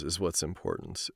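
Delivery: ordinary delivery, nothing marked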